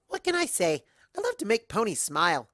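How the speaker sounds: cheery Pinky. Acted. Pinky answering cheerfully, high and bright. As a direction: beam with joy.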